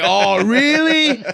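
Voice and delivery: high, slow mock-innocent sarcasm; sarcastic. Host mocking the plumber's warning with fake surprise. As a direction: play dumb with heavy sarcasm.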